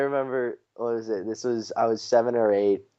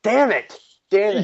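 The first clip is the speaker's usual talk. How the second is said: tag of frustration; 11 semitones higher, 5 dB louder than usual. Real, not acted. Misspelled the name, told correct answer.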